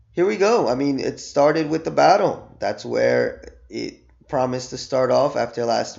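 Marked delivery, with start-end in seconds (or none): 0.1-0.7 excited. Real, not acted. Restarting the review.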